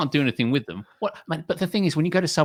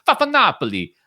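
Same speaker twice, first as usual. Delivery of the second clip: put-on Italian-American accent; 7 semitones higher, 5 dB louder than usual. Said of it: "I really can't do that accent".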